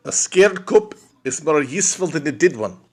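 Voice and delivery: a first, hushed take at a South African accent. Shown as a demonstration. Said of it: "South African accent, man".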